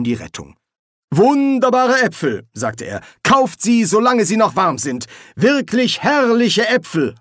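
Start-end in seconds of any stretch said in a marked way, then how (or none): 1.1-2.5 high, loud vendor's cry; market crier. A narrator's reading. Dean pretends to sell apples, calling out like a hawker. 3.2-7.1 high, loud sales patter; market crier. A narrator's reading. Dean pretends to be a merchant, crying out his wares. Dean improvises as apple seller.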